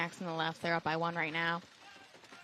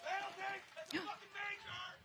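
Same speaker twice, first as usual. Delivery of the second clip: sharp gasp; 12 semitones higher, 8 dB quieter than usual. Real, not acted. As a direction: gasp in surprise. Tense moment in match.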